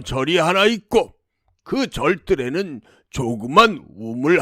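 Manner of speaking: measured demon voice, each detail spelled out; clearly explaining demon. Acted. Demon tells princess where his heart lies, clearly. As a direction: reveal the secret piece by piece, with relish. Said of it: "똑똑히 알려주었습니다".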